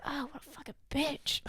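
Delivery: quiet, far-off muttered insult; muttered under breath. Retold or imitated. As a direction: grumble it half to yourself while walking away. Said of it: "didn't think that I could hear him".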